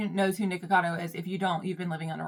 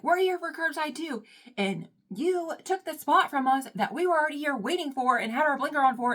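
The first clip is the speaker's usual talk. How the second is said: high, indignant protest building louder; exasperated retelling of a parking-lot telling-off; imitated yelling; 7 semitones higher, 5 dB louder than usual. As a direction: argue up high as the wronged driver. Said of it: "the things that she's yelling".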